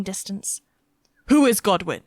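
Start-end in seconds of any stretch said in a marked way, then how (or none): 1.3-2.0 a knight calling out, louder; a Norman knight calling to a peasant girl, louder. A narrator's reading. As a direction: raise the voice across the square. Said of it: "he called out".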